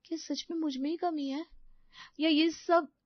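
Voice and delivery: high, plaintive self-questioning; hurt daughter-in-law. Acted. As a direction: wonder in a high voice why you failed. Voices Sapna's hurt inner thoughts.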